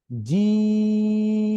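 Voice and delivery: জিম with the vowel held very long and steady; long stretch. Shown as a demonstration. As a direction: draw out জিম fully. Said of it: "যেহেতু এটা দীর্ঘ টান". (দীর্ঘ টানে জিম)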